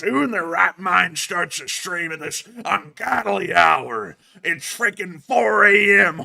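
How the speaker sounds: put-on grumpy, slow, tipsy old man; hungover old man. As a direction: grumble like a groggy old grump. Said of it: "old, grumpy, slightly drunk, slightly hungover man's voice".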